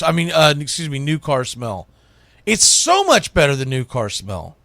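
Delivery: ordinary delivery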